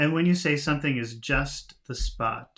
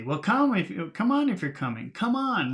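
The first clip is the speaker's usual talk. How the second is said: higher, louder impatient urging; impatient come on; 8 semitones higher, 5 dB louder than usual. Shown as a demonstration. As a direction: hurry someone along, come on at the top. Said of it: "That's what you say when you're waiting".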